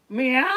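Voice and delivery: loud, high meow; too much cat. Acted. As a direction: wail it like a crying cat. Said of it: "That's too much cat".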